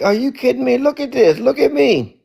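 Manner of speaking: high, excited disbelief; a man thrilled to be walking on water; amazed disbelief. Retold or imitated. Peter on the water, amazed at himself, high and excited. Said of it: "Peter was like".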